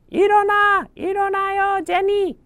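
dad calling Jenny out of bed; calling to wake up. Acted. Dad calling his sleeping daughter awake.

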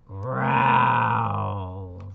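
loud growl from a polar bear. Acted. Teacher reading to kids, growling loud like a polar bear. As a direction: growl it big and bearlike. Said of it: "says the polar bear".

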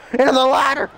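sudden loud burst of play-by-play; excited commentary shout. Real, not acted. Gamer calling a throw into the ladder, excited shout. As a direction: shout the impact as it lands.

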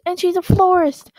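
ordinary delivery

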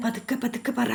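sudden drop to a near-whisper, hushing him; hushed warning. Acted. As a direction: urge him to keep it down, barely voiced. Grandma hushing the boy. (പതുക്കെ, ശബ്ദം താഴ്ത്തി വിലക്കുന്നു)

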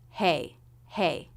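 "Hey" with a little rise, then falling; short "hey" that lifts and drops away; up-down curve. Shown as a demonstration. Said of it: "a little curve up and then a curve down".

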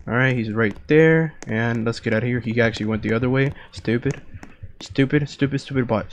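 usual speaking voice

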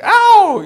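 high, loud "ow"; sudden high imitated yelp; mock cry of pain. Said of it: "it's not like, like".

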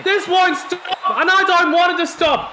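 high, shouted thrill; worked-up commentator, pitched high; excited ringside call. Pretend. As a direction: cry out like a fan lost in the fight. Revelling in the ongoing match action.

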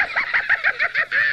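very high creature cackle; wild mischievous cackling; loud cackle. A narrator's reading. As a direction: cackle while running off. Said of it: "Bjorn cackled loudly".

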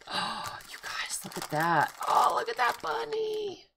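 whispered gasp rising to a high squeal; thrilled crafter squealing at a cute gift; squealing delight. Real, not acted. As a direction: go from a hush to a squeal over the bunny.

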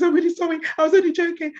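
mock remorseful colleague's voice; apologetic colleague. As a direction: plead like the sorry colleague. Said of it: "She goes".

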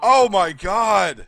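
loud, high, slow exclamation; thrilled disbelief. Real, not acted. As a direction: stretch it out, amazed by the close call. Awed after a close call.

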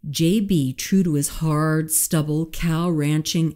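ordinary delivery, nothing marked

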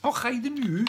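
high, hammed-up Scots rhyme; singsong mock Scots, pitched high; put-on Scottish accent. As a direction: play up a silly Scottish accent. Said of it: "that bloody accent come from".

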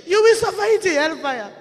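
loud, tearful preaching that pushes hard at the pastors; fiery preaching. Acted. Preacher warns pastors their flocks will go to hell, fiery tearful preaching. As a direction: rebuke them as if lives hang on it.

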